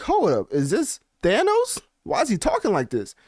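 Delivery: high-pitched disbelief, hold up; incredulous reaction. Retold or imitated. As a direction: act stunned by the villain talk. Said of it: "I'm like".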